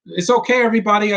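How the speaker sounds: hammed-up reassuring hero; mock hero voice. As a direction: call out to the crowd, voice up. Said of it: "he's like".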